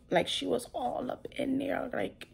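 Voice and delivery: hushed, secretive whisper; breathy girl-talk whisper; whispered aside. Retold or imitated. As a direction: lean in and whisper it like gossip. Gossiping about the ultrasound exam.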